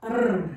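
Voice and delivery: R said as a soft, light tap. Shown as a demonstration. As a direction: say the R gently, without force. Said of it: "Suavecita la".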